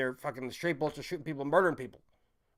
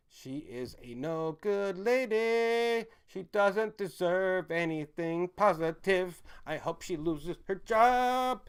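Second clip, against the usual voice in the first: slow, higher, emphatic delivery; deliberate slow contempt; slow drawn-out; 4 semitones higher, much slower than usual. Real, not acted. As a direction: stretch each word out with contempt.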